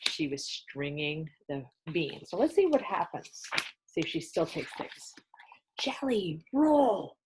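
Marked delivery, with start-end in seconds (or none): 3.9-5.1 hushed whispered aside; soft whispered comment; quiet aside. Real, not acted. Teacher aside to class. 5.8-7.2 breathy hushed exclaim, drawn out; slow whispered exclamation; excited exclamation. A narrator's reading. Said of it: "exclaimed Amelia Bedelia".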